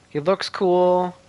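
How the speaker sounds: usual speaking voice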